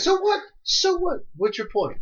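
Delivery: very high, loud dismissive comeback; incredulous challenge. Real, not acted. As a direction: challenge the excuse, pitch shooting up. Friend challenging his excuse mid-argument.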